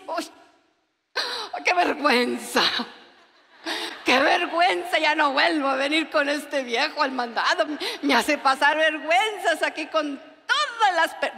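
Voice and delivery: mock embarrassed. Wife embarrassed by husband at store.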